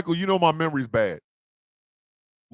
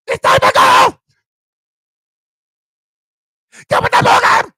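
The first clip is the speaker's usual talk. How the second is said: put-on much higher, loud shouted foreign accent; Austin Powers woman; 9 dB louder than usual. Comic mimics the Austin Powers woman, high shouted accent. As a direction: belt it like the Austin Powers villainess. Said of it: "that woman from Austin Powers".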